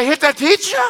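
high incredulous rising question. Retold or imitated. Guest repeating the pastor's question, high and incredulous. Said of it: "She said".

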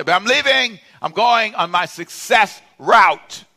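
high, loud, slow grand declaration; proud announcement. Retold or imitated. Telling his sleeping wife he's off, grandly proud. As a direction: proclaim it like a big mission.

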